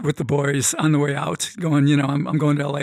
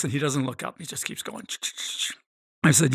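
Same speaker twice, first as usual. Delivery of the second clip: ordinary delivery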